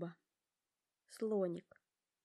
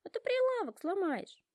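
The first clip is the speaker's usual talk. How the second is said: much higher, louder stern scolding; stern giraffe; 12 semitones higher, 5 dB louder than usual. A narrator's reading. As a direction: scold him sharply, high and loud. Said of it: "строго говорит Жираф".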